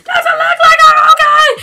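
high, loud furious yell half sobbing; shrieking outrage cracking into a laugh-cry; crying angry shout. Retold or imitated. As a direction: scream it through tears and laughter. Injured friend snaps back at her.

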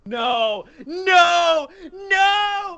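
high panicked shrieks; shrieking. Real, not acted. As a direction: shriek in mock horror. Freaked out by the snack.

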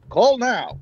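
high, punchy commercial announcer; hard-sell call to action; pushy announcer. Shown as a demonstration. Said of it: "If I come up to you like".

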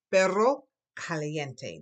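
perro caliente slow, rr rolled; drawn-out perro caliente with a trilled rr. Shown as a demonstration. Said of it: "your tongue must roll".

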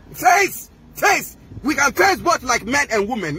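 face pitched high, then dropped; daring challenge. Real, not acted. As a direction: call her out, high and insistent. Daring a rival to fight.

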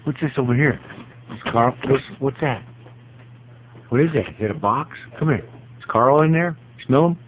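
high sing-song voice for the dog; high dog-talk voice. Real, not acted. Owner talks to dog Butters in high coo.

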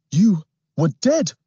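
put-on high, startled boy's blurt; freaked out. A narrator's reading. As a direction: gasp it out shaken. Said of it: "still a little freaked out".

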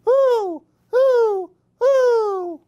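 high, loud owl hoots; hooting back. Acted. Baby Chick hooting back at the owl. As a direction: hoot back bravely like the owl. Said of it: "he hooted back".

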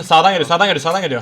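loud urgent callout; urgent shout. Real, not acted. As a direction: yell the warning fast.

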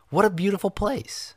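high, delighted exclamation; awed delight. A narrator's reading. Boy marvelling at the castle, awed and delighted.

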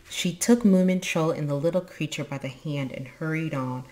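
ordinary delivery

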